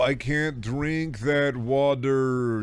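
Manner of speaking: very slow, drawn-out whine; mock picky thirsty man. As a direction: drag every word like a fussy customer.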